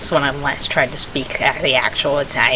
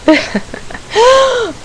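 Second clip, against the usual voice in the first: embarrassed laugh. Real, not acted. Laughing after the mishap.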